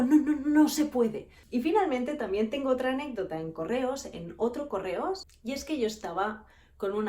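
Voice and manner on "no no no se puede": slow, louder nervous no; mock panicky clerk; panicked refusal. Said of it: "entró en pánico y dijo".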